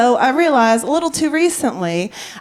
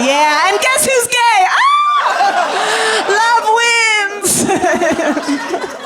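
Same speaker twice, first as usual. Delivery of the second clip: triumphant cheer; 10 semitones higher, much slower than usual. Real, not acted. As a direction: shout it out like a victory.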